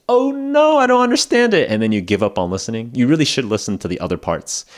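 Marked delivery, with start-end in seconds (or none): 0.1-1.7 high, loud hammed-up dismay; mock panic. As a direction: play a learner wailing in pretend despair. Voices a listener who gives up.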